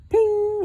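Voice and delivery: ping like a ball hitting a bell; high ping. A narrator's reading. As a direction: sing out a bright ping. Sound effects in story.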